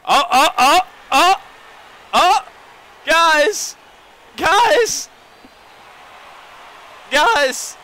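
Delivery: high, loud cries of real excitement; excited shouting. Real, not acted. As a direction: yell out to everyone, voice leaping up. Calling out after a home run.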